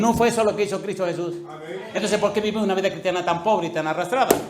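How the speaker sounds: loud, high rebuke; fiery preaching. Real, not acted. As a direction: shake the congregation awake. Preaching at full force.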